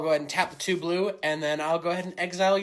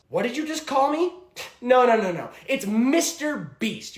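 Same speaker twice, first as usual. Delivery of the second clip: louder, higher barking boss; tyrant boss Mister Beast; 6 semitones higher, 5 dB louder than usual. Acted. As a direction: bark orders like a tyrant boss. Plays boss of challenge videos.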